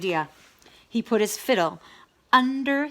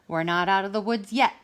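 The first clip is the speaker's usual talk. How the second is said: ordinary delivery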